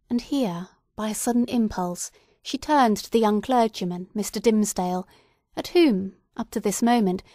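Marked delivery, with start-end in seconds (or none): none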